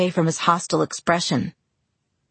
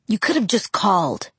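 harsh; 3 semitones higher than usual. Acted. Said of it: "he said harshly".

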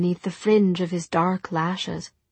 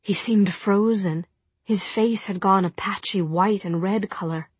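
usual speaking voice

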